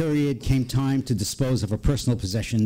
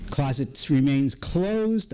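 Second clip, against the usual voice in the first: ordinary delivery, nothing marked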